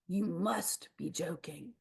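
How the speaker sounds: slow, breathless whisper of disbelief; shocked whisper. Acted. Snaps to bigger crocodile, horrified whisper. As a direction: whisper it in horror. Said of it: "and he says".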